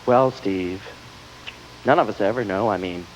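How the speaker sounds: low, hushed mock sympathy; patronising consoler. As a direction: talk down to him gently. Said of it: "I always hear things like".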